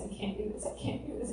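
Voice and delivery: breathless whisper of giving up; whispered despair. Retold or imitated. Retelling her collapse on the shower floor, whispered. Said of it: "sobbing, saying".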